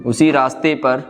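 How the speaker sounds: ordinary talk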